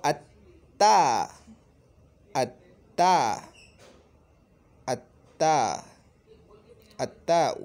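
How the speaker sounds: teacher drilling the assimilated l in अत्ता; doubled t demo. Shown as a demonstration. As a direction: lean hard on the doubled t in अत्ता. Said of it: "अब ये खींचेगा लाम को अपनी तरफ".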